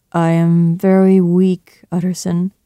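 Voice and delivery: slow, weary and drained; dreary. A narrator's reading. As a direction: drag the words, worn out. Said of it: "replied Jekyll drearily".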